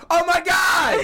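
high, loud burst of real excitement; thrilled shout. Real, not acted. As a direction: shriek it out in delighted recognition. Thrilled at recognizing the costume theme.

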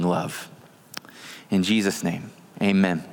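hushed pastoral prayer. Acted. Leads congregation in prayer.

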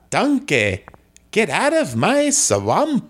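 botched Scottish accent sliding into Italian; attempted Scottish accent. Shown as a demonstration. Guest retries Shrek for the host, bad Scottish accent. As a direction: try Scottish, with an added vowel on swampa. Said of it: "I could try a Scottish accent".

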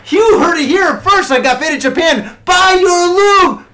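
hyped-up announcer, loud and high; loud mock ad pitch. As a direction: sell it like a late-night ad.

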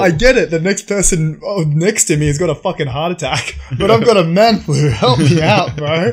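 high, loud whiny complaint; man flu whine. Retold or imitated. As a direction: whine for attention, very sick. Mocking man flu whining.

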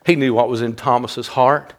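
ordinary delivery

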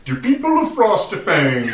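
high, slow pompous proclamation, laughing; a hammed-up noble addressing the town; silly lord voice. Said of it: "kept it going. That was pretty good".

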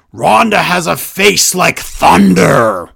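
a hint of a pompous commentator; imitating Barrett. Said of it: "And Barrett says".

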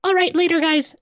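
very high, small squeaky voice; high-pitched voice. Acted. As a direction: squeak a farewell. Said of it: "Grem the wallet says goodbye".

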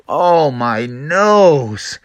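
slow, drawn-out moan of pain; hurt Froggy. Acted. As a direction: wail slowly over a bumped nose. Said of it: "he fell down and he was like".

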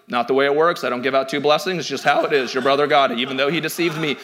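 low, unmoved father's refusal; matter-of-fact dad. Retold or imitated. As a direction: act out a dad laying down the rule, pitched low. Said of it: "And Dad's like".

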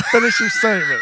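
playful demanding shout. Real, not acted. Pressing friend to finish.

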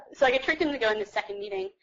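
normal talking voice

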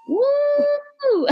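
long, high-pitched woo; celebratory whoop. Real, not acted. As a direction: let out a drawn-out cheer, way up high. Cheering International Women's Month.

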